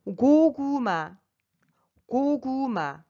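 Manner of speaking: 고구마 with mouth nearly closed on 고구, open on 마; Korean word demo. Shown as a demonstration. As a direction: model 고구마 with long, careful syllables. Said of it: "il faut ouvrir la bouche".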